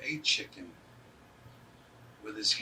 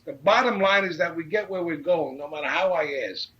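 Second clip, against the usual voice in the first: insistent arguing; 5 semitones higher, 7 dB louder than usual. Retold or imitated. Retelling his argument over asking directions.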